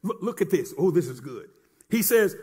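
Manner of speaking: ordinary delivery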